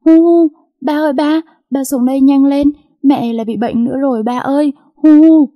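high, loud wailing through acted sobs; sobbing call for help. A narrator's reading. Girl calls father to the kitchen, loud tearful wail. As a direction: cry out for father between sobs. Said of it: "lau hai hàng nước mắt chảy dài".

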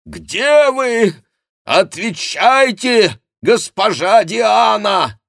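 high, drawn-out, full-voiced shouting; long, ringing shouted demand; piercing cry. A narrator's reading. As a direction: call out loudly for someone missing. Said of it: "Этот крик пронзил воздух".

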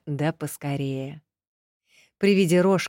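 plain reading voice

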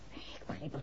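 hushed whisper, breath only. Acted. Woman telling the detective about the mystery call, whispered. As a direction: lean in and whisper it as a confidence. Confiding her puzzlement to the detective.

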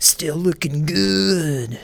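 stretched slow quote of the ad line; slow drawn-out ad quote. Retold or imitated. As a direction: draw out looking good slowly. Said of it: "I just always remember that".

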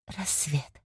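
soft breathy whisper of relief. A narrator's reading. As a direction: barely breathe the word. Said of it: "прошептала она". (шёпотом)

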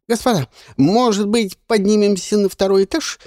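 high, eager peacemaking offer; smooth things over nervously; fussy polite old man. Acted. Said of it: "попытался разрядить атмосферу". (суетливый вежливый голос)

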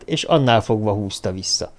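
ordinary delivery, nothing marked

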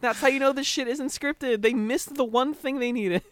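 high, incredulous ranting; excited high voice. Real, not acted. Mocking the contestants' failure.

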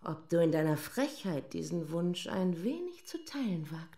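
a lady daring her servant in a whisper; seductive whisper. Acted. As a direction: whisper the dare, letting it die away.

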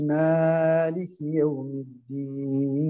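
مالك يوم الدين with the final vowels dragged out very slowly; slow recitation showing excess lengthening; extra madd. Shown as a demonstration. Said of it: "قلنا المد الزائد".

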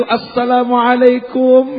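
slow, high, sweet drawn-out salam; drawn-out greeting. Retold or imitated. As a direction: stretch the greeting sweetly and slowly. Sincere greeting acted. (salam manis dan panjang)

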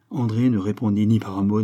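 usual speaking voice

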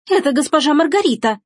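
high-pitched indignant squeal; squealed accusation. Acted. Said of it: "взвизгнула Эжени". (обвиняющий визг)

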